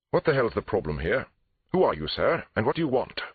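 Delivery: high, irate clipped English; clipped English accent. A narrator's reading. Suspect confronting police at the door, clipped English accent. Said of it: "he asked in a clipped English accent".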